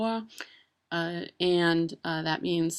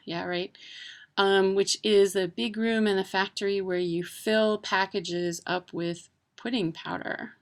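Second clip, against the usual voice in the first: ordinary talk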